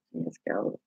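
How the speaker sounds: soft whisper of She is gold. Shown as a demonstration. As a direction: breathe the words out fondly. Repeats praise, fading out.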